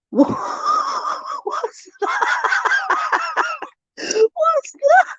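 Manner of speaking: startled squeal. Real, not acted. Reading a puzzling clue aloud, a high startled squeal. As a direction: yelp it out as if something jumped at you.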